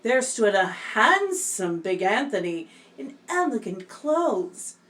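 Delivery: usual speaking voice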